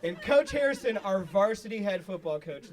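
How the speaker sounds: ordinary talk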